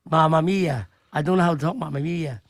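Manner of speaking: normal talking voice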